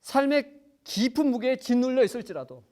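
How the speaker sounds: high, loud preacher's build; rising preaching. Acted. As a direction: lift the congregation with fervour. Preaching climax. (설교 절정의 높고 힘찬 목소리)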